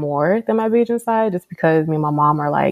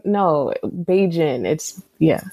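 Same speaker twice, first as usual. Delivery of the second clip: ordinary talk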